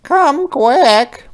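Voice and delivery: high, loud desperate call; crying out. Acted. Mother rabbit begs the fireman to hurry, high loud cry. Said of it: "Mother Rabbit cries".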